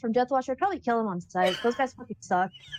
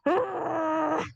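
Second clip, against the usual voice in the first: sob; 5 semitones higher than usual. Acted. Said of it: "buries their face into Pepper's fur and just goes".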